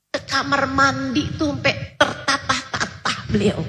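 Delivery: ordinary delivery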